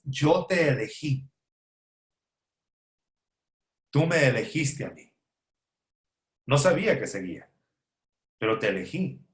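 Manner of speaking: más grave y suave, con ternura hacia su esposa; tender to wife. Retold or imitated. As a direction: dilo bajito, como una promesa íntima. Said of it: "le digo a Oneida, mi hermosa esposa". (a su esposa Oneida, tierno y suave)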